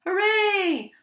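high, loud cheer; a drawn-out high hooray. Acted. Animals cheer as the hippo goes up, high cheering.

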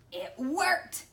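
ordinary delivery

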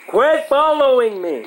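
high-pitched angry yell. Real, not acted. Gamer being tailed by another player, angry. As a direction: yell it at the pest behind you. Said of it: "Why are you so angry?".

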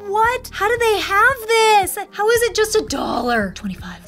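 high, stunned squeal of disbelief; can't believe the price, voice jumping up; shocked disbelief. Retold or imitated. Shopper retelling finding the socks, in high disbelief. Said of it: "I was like".

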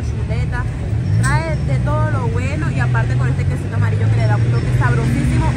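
talking past fingertips; licking fingers while talking; fingers at lips. Real, not acted. Seen 0: Fingertips touch her lips at +0.1 and +0.4, then the hand drops.

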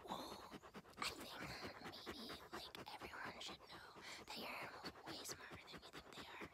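soft breathy whisper as the dog; dog's inner voice. Acted. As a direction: whisper the dog's wise words. Voicing the dog.

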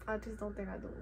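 unvoiced, hushed aside to herself; whispered aside. Real, not acted. Answering a viewer question, she whispers to herself. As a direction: drop to a whisper, almost to yourself.

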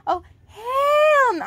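high, slow fake crying; mock wail. Reader pretends to cry over the story, high and wailing. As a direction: ham up a sobbing protest.

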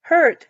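hurt said high and loud; stressed word hurt. Shown as a demonstration. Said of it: "he's hurt什么意思".

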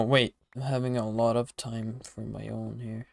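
normal talking voice

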